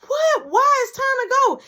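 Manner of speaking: high, loud disbelieving yell; acted exasperated outburst; retold outraged protest. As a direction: holler it at a friend who's dawdling. Acted protest at friend's delay.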